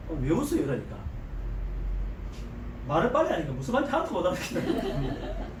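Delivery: ordinary talk, nothing marked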